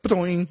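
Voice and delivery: high springy mock boing; boing sound effect. Describing his sudden arousal, high cartoon boing. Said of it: "it's like".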